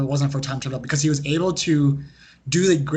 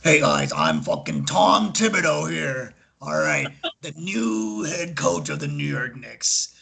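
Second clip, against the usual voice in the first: put-on slow, gruff Boston accent coach voice; Tom Thibodeau Boston accent; slower than usual. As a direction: drag out the words in a thick Boston accent. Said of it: "as you can tell by my impression".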